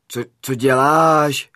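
taken aback, voice lifting; startled protest. Acted. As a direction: ask what on earth he's doing, stretched out and loud.

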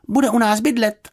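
put-on high, brisk girl's announcement; matter-of-fact declaration in a high voice; announcing. A narrator's reading. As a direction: announce it firmly, falling at the end. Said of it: "oznámila Juditka".

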